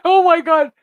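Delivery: laughing through a loud high "oh my God"; laughing disbelief. Real, not acted. Seeing sixteen hundred likes, laughing loudly in disbelief.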